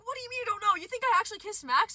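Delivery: high, hushed squeal of disbelief; freaking out. Retold or imitated. Asking friends if they believe the rumor, high freaked-out disbelief. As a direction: question them in a high, freaked-out pitch. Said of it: "So I'm freaking out".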